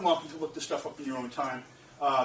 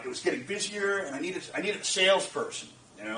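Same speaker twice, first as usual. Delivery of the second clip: usual speaking voice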